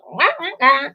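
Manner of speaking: mock blabbering noise; imitated chatter. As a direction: babble wordlessly to mimic nonstop talking. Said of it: "I'm just like".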